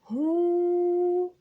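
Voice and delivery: هو held long and high; long vowel. Shown as a demonstration. Teacher holding the long oo for kids. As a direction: draw out the oo of هو. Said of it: "طولت الضمة".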